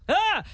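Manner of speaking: short, high mock yelp; awkward cringe yelp. Host cringing at an old memory, short high yelp. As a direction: let out a brief high cry of embarrassment. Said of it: "it's also awkward to see it 'cause you're like".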